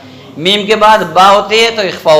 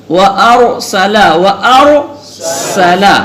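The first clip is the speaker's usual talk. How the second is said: slow, drawn-out Quran recitation, each word separate; much slower than usual. Shown as a demonstration. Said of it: "अलग-अलग पढ़ रहा हूँ". (अलग-अलग, ठहर-ठहर कर तिलावत)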